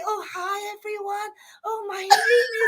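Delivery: lightly mocking a shy, proper speaker; mock timid intro. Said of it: "I can't come here and say".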